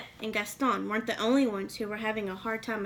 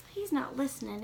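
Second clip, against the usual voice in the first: plain reading voice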